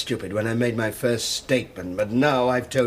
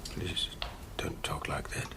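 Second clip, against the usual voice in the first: barely audible low whisper; whispered, pained request; hushed plea; 9 semitones lower, 14 dB quieter than usual. Acted. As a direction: beg him softly to stop. Suspect upset, begging interrogator to stop.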